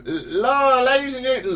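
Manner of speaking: very high carnival barker; high-voiced British dude. Shown as a demonstration. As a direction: call out like a showman way up high. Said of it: "high-voiced British dude".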